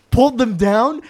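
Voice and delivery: ordinary delivery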